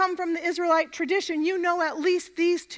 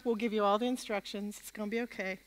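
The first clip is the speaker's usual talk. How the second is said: much softer, lower, calming; hushed comfort to nervous volunteers; soft reassurance; 5 semitones lower, 9 dB quieter, faster than usual. Real, not acted. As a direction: settle them down gently.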